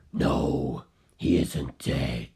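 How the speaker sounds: deep low voice for Carl contradicting the detective; Carl's voice. Acted. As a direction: drop the voice far down and take it slowly.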